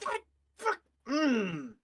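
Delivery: high-pitched, incredulous fury; outraged. Acted. Tara learns who took her kill, high incredulous outrage. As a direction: seethe in disbelief, pitched high. Character reacts to betrayal revealed.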